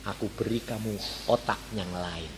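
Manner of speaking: low, hushed divine promise; God. Retold or imitated. God answers the pupil's question, low and hushed. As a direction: drop right down, very soft and unhurried. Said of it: "Tuhan bilang".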